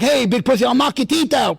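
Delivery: mock street-tough menace; aggressive threat. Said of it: "the aggressive one was me and Big Pussy".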